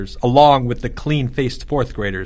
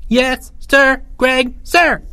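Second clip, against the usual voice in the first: high little soldiers' reply; small dutiful voices; 13 semitones higher, 3 dB louder, much slower than usual. A narrator's reading. Two underlings replying to their general, small dutiful voices. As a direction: answer smartly in a high voice. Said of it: "said two voices in unison".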